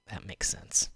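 whispered to herself, almost unvoiced; whispered aside. Real, not acted. Rounding off her tech thoughts in a whisper. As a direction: let the thought slip out as a whisper.

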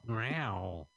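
playful imitation of a cat; mock catty meow. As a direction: meow at a bitchy remark. Catty reply to snotty quote.

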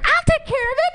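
put-on high, tiny creepy girl voice; a sweet little girl who is secretly deadly. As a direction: squeak it out like a menacing little kid. Voicing the scary little girl.

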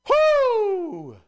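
high loud preacher's whoo; preacher whoop. Retold or imitated. Mimicking a preacher's energy on the stream, a high whoop. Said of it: "The energy that a preacher".

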